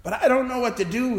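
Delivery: a hint of tearful begging; imitating crying. Weeping man apologising to the chaplain, mildly tearful. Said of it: "he cried".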